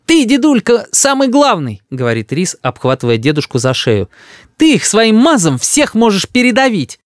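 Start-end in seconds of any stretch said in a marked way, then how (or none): 0.0-1.8 put-on high child's voice, wheedling; adoring little boy. A narrator's reading. Boy hugs his grandfather, flattering. 4.6-7.0 put-on high, eager child's voice; boastful little boy. A narrator's reading. Boy praises grandfather's might, eager.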